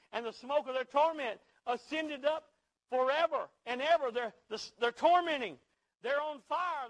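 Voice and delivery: thundering sermon on hell; preaching at full force. Acted. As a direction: preach it hard to the congregation.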